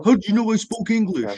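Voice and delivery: pitched well up, mock astonished question; mock amazed local. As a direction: play the baffled local, amazed he guessed. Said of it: "people think I'm a mind reader".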